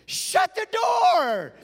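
very high, drawn-out shout; mock shriek, each word stretched; imitating shrieking mom. Mom playing along at the bedroom door, high mock shriek.

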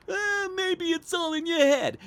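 high-pitched mocking landlord voice; put-on high voice, brushing it off; dismissive landlord. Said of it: "Then he says".

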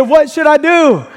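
high, loud, eager shout; overjoyed. Acted. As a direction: yell the question up, elated. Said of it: "the man's elated, he's overjoyed".

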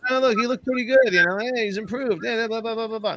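high mocking singsong of the doubters; mocking everyone. Mocking fans who say the quarterback looked good, high sneering voice. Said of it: "Everyone says".

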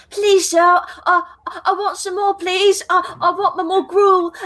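high pleading Oliver Twist voice, British accent; old-timey British orphan. Shown as a demonstration. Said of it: "your old-timey British orphan is your standard Oliver".

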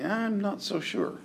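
slow, high, soft doubt; softly unsure, pitched well up; doubtful listener. Acted. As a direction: draw it out as a skeptical inner voice. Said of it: "you can still say".

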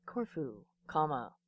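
hushed title-card voice; fancy voice. Pretend. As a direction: softly read out the screen caption, punctuation included. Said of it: "I have to use my fancy voice".

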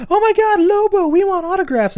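put-on high, loud squealing fan kids; excited kids. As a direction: shriek it like starstruck kids. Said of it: "these kids go".

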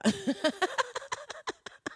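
high-pitched delighted laugh; high giggle. Real, not acted. Laughing at her own joke, high giggle. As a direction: break into giggles.

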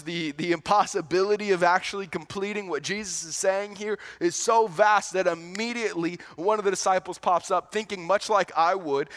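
ordinary talk

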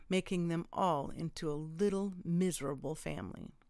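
plain reading voice

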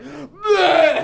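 loud, high retching blurt; vomiting noise. Retold or imitated. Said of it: "I just made a puke noise".

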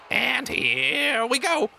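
very high, slow sing-song; goblin clown Soup. Acted. Soup announcing the bounce to the crowd, sing-song. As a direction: stretch it out like a showman. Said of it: "Soup goes".

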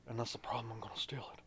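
rushed, secretive whisper; Nick Cage whisper. Retold or imitated. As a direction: hush it like you're confessing a heist. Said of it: "You gotta whisper it".

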